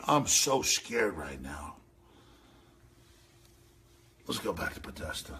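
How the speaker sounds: deadpan fake fright; low murmured mock fear; sarcastic fear.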